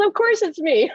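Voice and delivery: high, loud husband's reply; exuberant, laughing confirmation; imitated gleeful exclamation.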